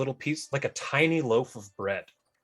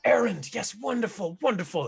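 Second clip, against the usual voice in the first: higher, excited greeter gushing yes yes; 9 semitones higher than usual. Acted. [name] greets the party, high and gushing. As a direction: fawn over the tall guest like an overjoyed host.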